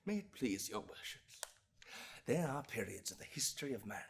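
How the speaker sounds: soft, low and grave; hushed address to the bench; subdued appeal. Acted. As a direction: pull right back after the storm. Resumes plea after outburst.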